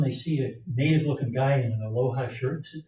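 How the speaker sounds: usual speaking voice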